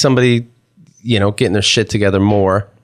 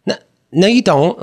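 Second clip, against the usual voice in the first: wife's mild angry denial; 9 semitones higher than usual. Retold or imitated. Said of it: "she got angry".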